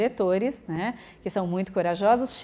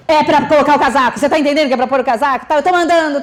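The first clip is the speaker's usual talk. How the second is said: much louder, higher angry orders, fast in the middle; bossy yelling; 4 semitones higher, 9 dB louder, faster than usual. Shown as a demonstration. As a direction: bark the orders like a fed-up parent. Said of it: "É diferente falar assim".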